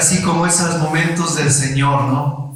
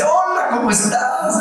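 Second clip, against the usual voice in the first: put-on girl on the phone; 11 semitones higher than usual.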